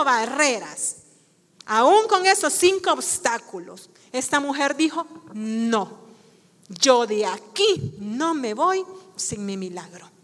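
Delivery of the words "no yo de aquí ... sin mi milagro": low, restrained refusal; understated, determined statement; quiet resolve. Acted. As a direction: hold your ground in a low voice. Woman's refusal, first quiet take.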